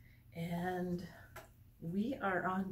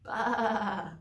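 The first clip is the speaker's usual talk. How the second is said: lamb bleat; 6 dB louder than usual. Acted. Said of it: "The little lamb shivered".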